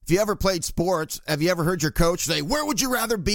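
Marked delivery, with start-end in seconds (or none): 2.4-3.3 lightly acted coach's pep talk, raised pitch; a higher-pitched rallying question, lightly put on. Coach to players before a game, lightly acted pep talk. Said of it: "trying to get you pumped up".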